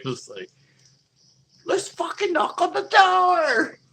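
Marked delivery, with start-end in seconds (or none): 1.7-3.8 put-on high, slow, loud drunken yell; drunk shout. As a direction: howl it like a drunk tourist daring the others. Drunk guys urging.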